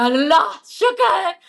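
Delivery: high, loud "thank God"; loud relieved exclamation; loud relief. Retold or imitated. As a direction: cry out in sheer relief. Relief when friends arrive.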